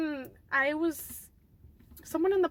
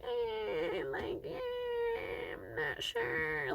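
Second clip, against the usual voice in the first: hesitant reluctance; 9 dB quieter, much slower than usual. Retold or imitated. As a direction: hem and haw in a small, squeaky voice. Acts out reluctance to return.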